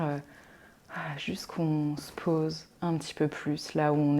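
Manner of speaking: soft, calm relaxation voice with long pauses; guided meditation. Acted. Yoga teacher settling listeners into Yoga Nidra, calm guided meditation. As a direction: ease them gently into rest, leaving space to breathe.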